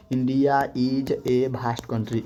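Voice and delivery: very slow, each word apart; drawn-out board example; slow dictation. Shown as a demonstration. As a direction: dictate it for students to copy. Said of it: "हम लिख देते हैं".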